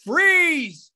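loud, high police command; loudspeaker yell. Acted. Cop to the naked runner in the alley, loudspeaker yell. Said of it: "he yells into the loudspeaker".

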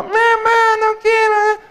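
put-on high, slow, wailing little child; child crying out. Child begs his mother to stop the shot. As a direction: whine it like a kid refusing a shot. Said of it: "empieza a gritar".

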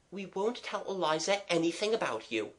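high, soft promise; reassuring child. Acted. Child promising not to tell Eliza, softly reassuring.

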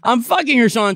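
high, loud laughing protest. Real, not acted. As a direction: shout the joke back.

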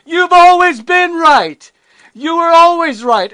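mock begrudging yell of you've always been right; grudging outburst. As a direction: blurt it out like a sore loser forced to admit it. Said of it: "they don't say".

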